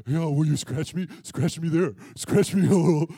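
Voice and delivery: low, loud goofy dog voice; deep, eager doggy voice; excited talking dog. Retold or imitated. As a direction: beg eagerly for scratches as the dog. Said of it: "all excited".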